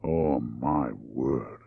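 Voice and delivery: low, very slow, softened voice; hushed, lingering exclamation; soft and drawn out. Acted. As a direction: breathe it out slowly and softly. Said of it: "The Australian said softly".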